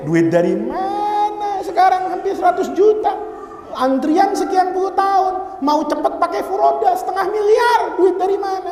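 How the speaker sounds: high-pitched, exasperated excuse; hammed-up complaint, pitched well up; mock protest. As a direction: protest the cost, stretching out the question. Acted excuse for not going on Hajj.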